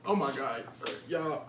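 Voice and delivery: ordinary talk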